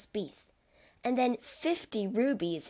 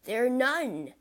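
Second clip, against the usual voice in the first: groaning no; 4 semitones higher, 4 dB louder than usual. A narrator's reading. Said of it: "Danglars groaned".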